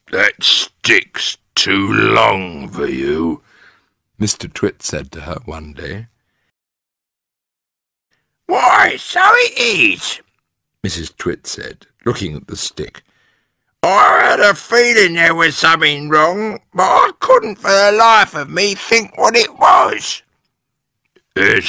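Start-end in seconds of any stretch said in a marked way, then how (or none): 0.1-3.4 slow, loud character voice; very slow, louder, teasing husband; Mr Twit. A narrator's reading. Mister Twit taunts his wife. 8.5-10.2 very high, loud screechy wife; high-pitched, slow shriek of surprise; Mrs Twit. A narrator's reading. Missus Twit startled by the stick. 13.8-20.2 high, loud screechy wife; shrill, puzzled wife voice; Mrs Twit. A narrator's reading. Missus Twit's character voice.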